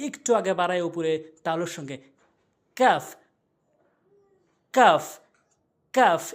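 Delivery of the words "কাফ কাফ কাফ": "কাফ" with light k, forward of qaf; crisp separate "কাফ", just ahead of the tongue root; কাফ demonstration. Shown as a demonstration. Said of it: "এভাবে আমরা উচ্চারণ করব". ("কাফ", গোড়া থেকে একটু আগে বাড়িয়ে)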